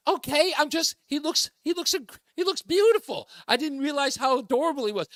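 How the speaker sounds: high, gushing imitation of the wife; smitten wife. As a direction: swoon over the singer in a high mock voice. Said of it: "She was like".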